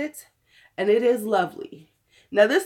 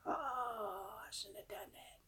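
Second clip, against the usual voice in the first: soft unvoiced whisper; whispered aside; 22 dB quieter, slower than usual. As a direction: breathe it out as a regretful whisper. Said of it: "voicing pet or self".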